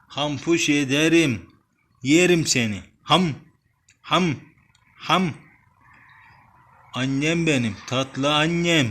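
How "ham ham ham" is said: playful munching noises. Pretend. Playful pretend biting sounds.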